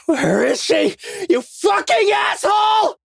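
very high, loud desperate shout; furious cry. A narrator's reading. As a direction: cry out in rage, demanding an answer. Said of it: "I cried".

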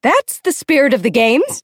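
high, affected enthusiasm; gushing escort. A narrator's reading. Escort praising the volunteer, high and gushing. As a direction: gush like a perky pageant hostess. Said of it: "gushes Effie Trinket".

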